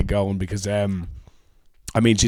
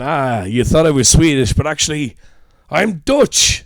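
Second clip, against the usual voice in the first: mock villain reveal; 3 semitones higher, 7 dB louder, slower than usual. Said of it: "the idea of going".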